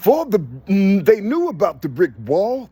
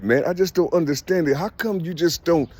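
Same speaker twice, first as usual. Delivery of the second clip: ordinary delivery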